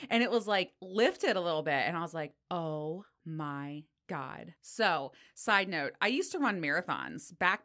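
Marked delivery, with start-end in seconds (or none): 2.5-4.5 drawn-out, softer realization; slow dread. Retold or imitated. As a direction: stretch each word as it sinks in. Acted dawning realization about toenail.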